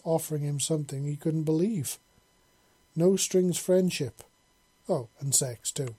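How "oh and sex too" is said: ordinary delivery, nothing marked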